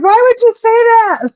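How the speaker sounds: very high, loud why would you; protesting to the friend, very high and loud; horrified protest. Retold or imitated. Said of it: "I'm like".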